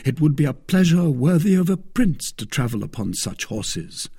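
lightly voiced admiring Aramis. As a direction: lightly lift the pitch in admiration.

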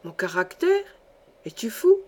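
incredulous questions leaping up in pitch; stupefied. A narrator's reading. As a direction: question it in sheer disbelief. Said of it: "Stupéfait, Alfred ouvrait de grands yeux". (stupéfait, incrédule)

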